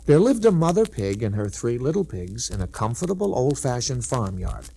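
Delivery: ordinary delivery, nothing marked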